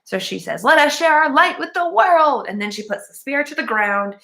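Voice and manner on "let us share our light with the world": lightly acted heroine's proclamation, higher pitched; grand declaration. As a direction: proclaim it loftily, a touch theatrical.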